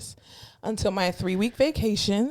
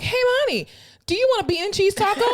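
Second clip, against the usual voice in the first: high bright coworker voice; 10 semitones higher, faster than usual. Retold or imitated. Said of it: "she's like".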